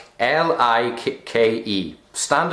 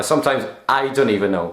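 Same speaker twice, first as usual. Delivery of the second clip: ordinary delivery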